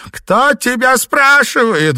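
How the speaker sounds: high, loud, slow squeal; shrieking rebuke. A narrator's reading. Wife cutting off the driver, shrieking. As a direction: shriek him down. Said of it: "взвизгнула Диана".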